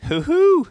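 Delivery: the killer laughing off Rob's curse; mocking hoot. A narrator's reading. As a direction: hoot like he's truly amused.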